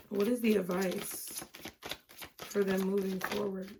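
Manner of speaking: soft, hushed prayer to the spirit guides; quiet invocation. Acted. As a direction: call on the angels softly, like a prayer. Calls on guides for an advice card.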